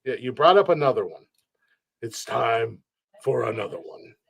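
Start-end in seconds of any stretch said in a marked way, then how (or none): 2.0-4.2 slightly lower, softer take on the catchphrase; a hint of a deep bass impression; deep-voiced catchphrase. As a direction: lightly attempt a deep character voice. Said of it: "I can do a deep ass voice".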